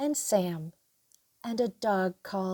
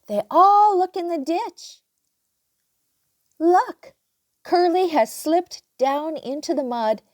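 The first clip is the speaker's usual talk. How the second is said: excited; 6 semitones higher, 6 dB louder than usual. A narrator's reading. Excited discovery in story.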